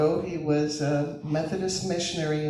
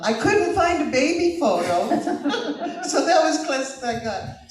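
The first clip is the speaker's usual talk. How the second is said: real laugh bubbling through, pitched up; 9 semitones higher, 5 dB louder than usual. Real, not acted. To the club about the photo, laughing. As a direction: giggle through the words.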